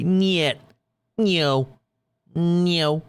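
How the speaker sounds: high, punchy mock Russian "Niet", then "No". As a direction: play a curt Russian saying no. Trying out what niet means.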